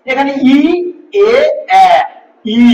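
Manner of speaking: ই এ এ ই each said alone with spread lips; teacher showing spread vowels; spread vowels demo. Shown as a demonstration. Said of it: "প্রসারিত".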